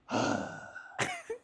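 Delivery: long, breathy mock sigh; exasperated sigh. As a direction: sigh heavily like a put-out clerk. Acts out the clerk's exasperated sigh.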